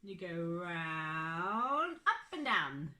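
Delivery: slow, drawn-out teaching voice; slow demonstration. Shown as a demonstration. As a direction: guide each stroke slowly. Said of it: "You start here".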